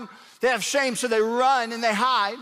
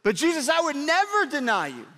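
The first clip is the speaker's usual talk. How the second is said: mildly hammed-up, higher-pitched protest; flabbergasted Peter; 5 semitones higher than usual. Disciple insisting to Jesus he is his friend, mildly flabbergasted. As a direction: plead loyalty with a touch of disbelief. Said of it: "Peter is just flabbergasted".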